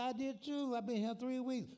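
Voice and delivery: put-on high, loud old man greeting a friend. Old friend hailing Sam in Jackson, high old man voice. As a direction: pitch it up like a chirpy old timer calling out.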